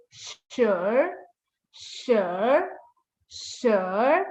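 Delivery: SURE as "shrr", dipping then rising; R-like ending. Shown as a demonstration. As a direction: curl SURE into an r sound. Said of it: "has like a R sound".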